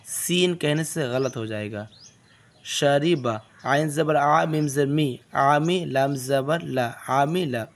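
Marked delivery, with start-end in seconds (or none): none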